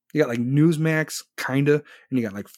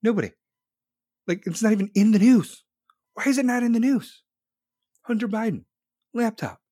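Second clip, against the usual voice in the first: much higher, louder indignant rant; rising outrage; 9 semitones higher, 4 dB louder than usual. Real, not acted. Ranting to chat about the media, higher outraged voice. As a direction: climb into exasperated outrage.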